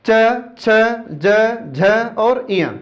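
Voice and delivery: च, छ, ज, झ, इया each sounded apart, slowly; teacher reciting च छ ज झ इया slowly for students to repeat; slow letter-by-letter recital. Shown as a demonstration. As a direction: spell the sounds out slowly with pauses between. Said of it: "ये आपको ध्यान रखना होगा".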